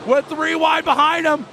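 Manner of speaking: ramp up as the field roars off; excited race call. Real, not acted. Restart calling the field.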